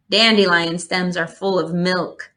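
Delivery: ordinary delivery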